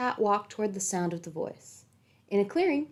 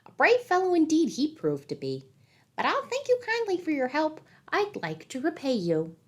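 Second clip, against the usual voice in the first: high, sly little-man voice; leprechaun; 8 semitones higher than usual. A narrator's reading. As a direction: speak like a wry leprechaun. Leprechaun speaks to Pat.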